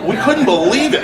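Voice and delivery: high, loud laughing; laughing disbelief. Real, not acted. Recalling hearing friend named most wanted, laughing.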